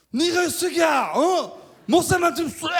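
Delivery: put-on high, slow drunken yell at the pastor; drunken cursing. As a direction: slur a rude drunk shout. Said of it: "욕을 막 하는 거예요".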